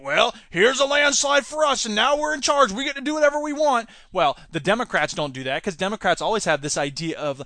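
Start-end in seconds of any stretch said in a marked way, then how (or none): 0.0-3.9 higher, cocky victor's voice; mock party boss, pitched up; gloating winner. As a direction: crow like a party that just won big. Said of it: "it's like".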